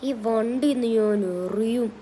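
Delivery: slow, heavy put-on monster voice; Hulk voice. Game hero turned Hulk threatens to throw the car, slow monster voice. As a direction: growl each word out slowly like a giant. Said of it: "എനിക്ക് sound മാറി സംസാരിക്കാൻ പറ്റുന്നുണ്ടല്ലോ".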